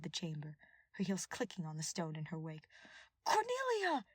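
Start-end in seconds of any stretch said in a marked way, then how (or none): 3.2-4.0 high, loud furious scream of her name. Acted. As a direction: hurl the name after her. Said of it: "Ash screamed after her".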